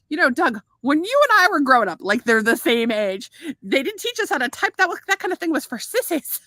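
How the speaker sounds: light imitation of an Irish doctor; doctor's Irish accent. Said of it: "did everything with the accent".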